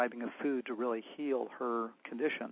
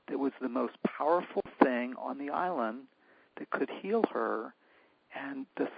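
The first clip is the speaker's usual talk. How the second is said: ordinary talk, nothing marked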